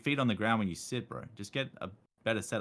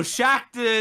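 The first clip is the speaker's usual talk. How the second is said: higher, louder mock shout of Shaqtin'; mock yell; 14 semitones higher, 9 dB louder than usual. Shown as a demonstration. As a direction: shout Shaqtin' like trash talk. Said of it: "gotta yell".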